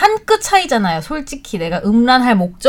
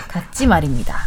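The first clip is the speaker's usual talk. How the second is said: lower, softer soldier speak; korean military style; 6 semitones lower, 5 dB quieter than usual. Acted. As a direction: deliberately play the private. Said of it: "이거 굉장히 군대 말투인데". (낮고 조용한 군대 말투)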